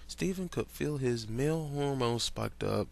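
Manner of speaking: ordinary delivery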